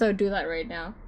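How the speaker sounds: normal talking voice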